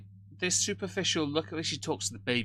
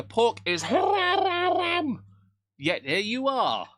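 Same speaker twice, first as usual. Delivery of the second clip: exaggerated mock outrage; 13 semitones higher, 7 dB louder, much slower than usual. Teasing friend about Syria.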